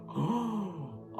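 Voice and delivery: shocked gasp. Real, not acted. Reacts to the Trump quote.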